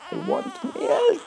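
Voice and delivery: put-on high, loud baby cry for milk; wailing infant. An abandoned infant wails for milk.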